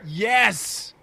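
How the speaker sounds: loud, high burst of delight; excited yes. Real, not acted. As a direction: cheer the plan with a real whoop. Thrilled by plan to spray paint.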